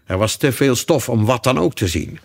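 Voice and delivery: ordinary delivery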